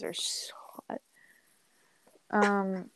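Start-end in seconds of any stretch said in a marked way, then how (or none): 0.0-1.0 breathy confession whispered. Real, not acted. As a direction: let it slip out in a whisper. Confessing a crush on accents.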